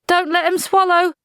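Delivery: Polly yelling to Maledict; yelled. Acted. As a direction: shout a warning across the field. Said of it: "Polly yelled".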